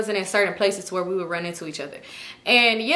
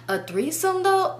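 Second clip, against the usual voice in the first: high, drawn-out disbelief; mock incredulous; 9 semitones higher, slower than usual. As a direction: imitate his scandalized question, high and slow. Retelling his disbelieving accusation.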